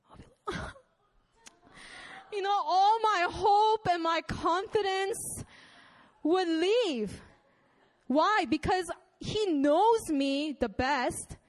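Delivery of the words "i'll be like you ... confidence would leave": breaking into a high quick laugh mid-line; giggling through the words. Real, not acted. Laughs at imagined hurt.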